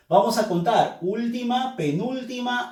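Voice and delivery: ordinary talk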